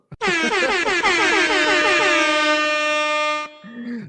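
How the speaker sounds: long loud high burst of laughter. Real, not acted. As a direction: crack up loudly at the news. Big gift announcement, cracking up.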